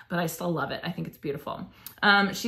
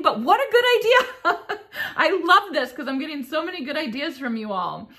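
laughing delight; 9 semitones higher, 3 dB louder than usual. Real, not acted. Laughing delight at good idea.

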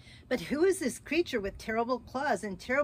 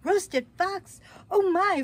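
high, louder startled "Roasted fox? Oh my"; frightened fox; 10 semitones higher, 8 dB louder than usual. Acted. The fox, hearing he is the Gruffalo's food, alarmed. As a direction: gasp it like a fox who just heard he's dinner.

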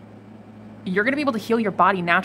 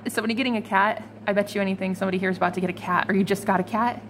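ordinary talk

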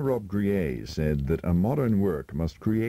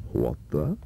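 emphatic what the; 4 semitones lower than usual. Shown as a demonstration. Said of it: "such emphatic interrogatories as".

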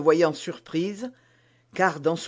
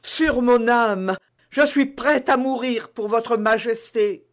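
heartfelt vow; 7 semitones higher, 7 dB louder than usual. A narrator's reading. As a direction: swear from the bottom of her heart. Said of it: "Ce cri était sorti du plus profond du cœur". (serment passionné, plus aigu)

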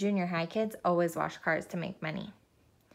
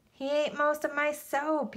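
mild narrated yell; 7 semitones higher, slower than usual. A narrator's reading. Arthur to the dog's owner about eaten soap, mild yell. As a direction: wail it slowly in protest. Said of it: "yelled Arthur".

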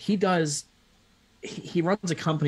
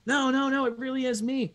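insistent caller; 12 semitones higher than usual. Retold or imitated. Gerhard insisting on the phone.

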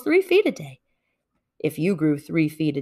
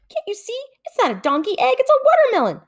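exasperated; faster than usual. A narrator's reading. Hare exasperated with Bear.

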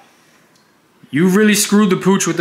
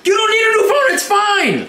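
shouting. Real, not acted. Argument over frozen phone.

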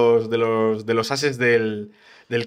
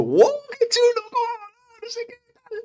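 emoción exagerada. Retold or imitated. Imita su propia reacción emocionada.

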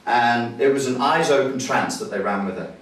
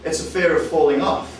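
usual speaking voice

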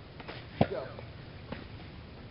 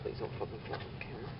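whispered plea; 6 semitones lower, 11 dB quieter than usual. Real, not acted. Muttered plea under breath.

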